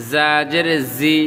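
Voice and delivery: 'জা' and 'জি' with a thin buzzing z. Shown as a demonstration. Teacher compares letters, 'জি' with a thin z. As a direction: keep 'জি' a light z. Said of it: "পাতলা করে".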